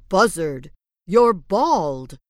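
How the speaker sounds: loud, startled announcement; shocked exclamation. Acted. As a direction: blurt out the shock. Wolf exclaims.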